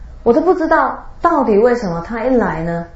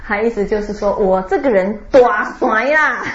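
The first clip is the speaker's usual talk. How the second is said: normal talking voice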